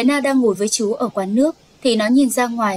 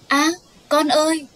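higher-pitched acted cry of alarm; 5 semitones higher than usual. Mother sees her daughter hit by a car, acted alarmed cry. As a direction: cry out in shock to your child.

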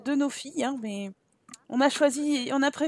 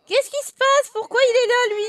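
much higher astonished question; shocked surprise; 9 semitones higher, 4 dB louder than usual. Real, not acted. Spotting an uninvited uncle, much higher and shocked. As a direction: react as if someone barged in. Unexpected guest appears.

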